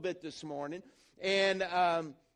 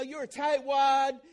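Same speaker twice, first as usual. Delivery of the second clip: mocking label; 5 semitones higher, 7 dB louder, slower than usual. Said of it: "you know".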